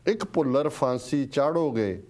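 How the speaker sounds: slow, measured verse with swelling, falling line ends; stirring, drawn-out poetry reading; poem recitation. Real, not acted. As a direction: declaim each line deliberately, pausing between phrases. Recites a listener's poem aloud.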